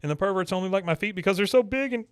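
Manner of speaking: high, fast, whiny mock sob; hammed-up tearful wail; mock crying Peggy. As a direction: imitate Peggy crying, voice rising. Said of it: "she starts crying again".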